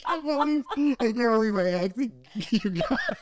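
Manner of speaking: high giddy disbelief; squeaky high voice. Real, not acted. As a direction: still in a high cartoon-mouse squeak. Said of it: "I sounded like Mickey Mouse".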